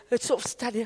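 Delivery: usual speaking voice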